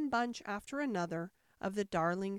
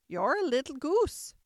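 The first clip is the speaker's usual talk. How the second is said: high, loud scolding; raised, dismissive character voice; scornful Sheep; 5 semitones higher, 7 dB louder than usual. A narrator's reading. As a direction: pronounce judgement as the testy Sheep. Sheep insults Alice.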